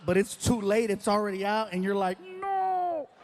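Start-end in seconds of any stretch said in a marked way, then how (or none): 2.2-3.1 high, strained no; a panicked no as it slips out; high, stretched denial; horrified no. Retold or imitated. Regret as words come out.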